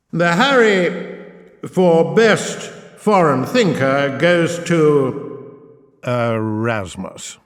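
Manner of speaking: grand, slow, drawn-out awards host voice; milk the suspense like an Oscars presenter; awards announcer. Pretend. Host reading out the Best Poet award, in a grand slow announcer voice.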